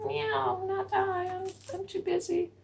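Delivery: high mewing cat voice. Acted. Storyteller voices the cat refusing to help. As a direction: play the lazy cat.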